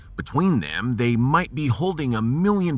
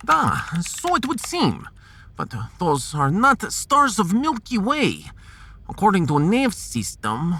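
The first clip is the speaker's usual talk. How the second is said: put-on a higher-pitched Russian-accented scientist, patient and precise; 7 semitones higher than usual. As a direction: play the Russian science officer calmly explaining the stars. Russian science officer explains.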